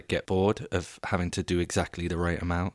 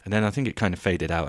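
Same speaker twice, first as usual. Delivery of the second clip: ordinary talk, nothing marked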